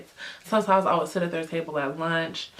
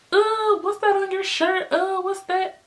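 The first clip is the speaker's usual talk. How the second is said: much higher, startled asking; surprised classmates; 12 semitones higher than usual. Retold or imitated. Classmates spotting a stain, high and startled. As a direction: point it out in alarm. Classmates pointing at her shirt.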